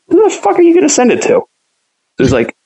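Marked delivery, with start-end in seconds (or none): 0.1-1.4 incredulous retort. Retold or imitated. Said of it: "And I was like".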